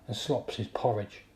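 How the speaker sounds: plain reading voice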